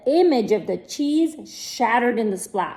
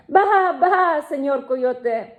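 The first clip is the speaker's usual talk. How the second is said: lamb bleat; 4 semitones higher, slower than usual. Acted. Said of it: "Borreguita bleated".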